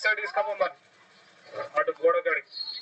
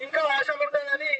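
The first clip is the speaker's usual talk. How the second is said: lively, raised play-by-play call; excited cricket commentary; 6 semitones higher than usual. Real, not acted. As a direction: call the chase with rising excitement.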